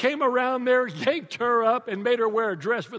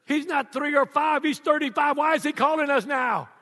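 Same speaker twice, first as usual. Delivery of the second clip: higher, louder exasperated parent; exasperated dad; 6 semitones higher, 4 dB louder than usual. Retold or imitated. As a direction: complain like a tired father at night. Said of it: "I'm going".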